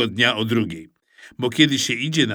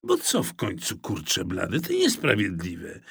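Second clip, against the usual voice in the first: sulky; 5 semitones lower, 6 dB quieter than usual. A narrator's reading. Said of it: "bo byłem jeszcze obrażony".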